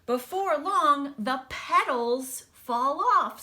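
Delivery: lively storybook read-aloud voice; picture-book reading with big pauses; reading to children. Acted. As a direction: read it brightly to a class of kids. Teacher reads picture book aloud.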